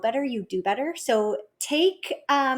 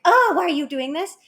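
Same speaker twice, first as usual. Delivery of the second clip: higher, louder exasperated yell; mock yell at child; 7 semitones higher, 6 dB louder than usual. Parent losing patience with child, yelling in mock exasperation. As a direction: snap at the kid in frustration. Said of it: "when you're gonna yell".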